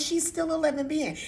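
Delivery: mildly scolding grandmother; firm reprimand. Retold or imitated. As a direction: make the point firmly to a child. Retelling her scolding of grandson.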